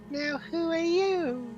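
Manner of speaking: high, slow, eerie little voice; a tiny voice inside your head; little pixie voice. Acted. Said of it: "you hear a little voice say".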